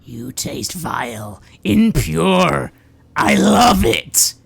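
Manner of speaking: slow, gloating monster relishing its prey. Acted. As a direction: savour each word like a hungry beast. GM voices the monster feeding.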